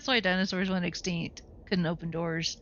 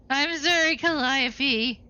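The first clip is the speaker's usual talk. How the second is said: exaggerated apology; 6 semitones higher, 3 dB louder, slower than usual. Same apology repeated.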